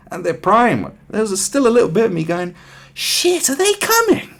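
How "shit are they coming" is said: mock panic. His own inner fearful voice.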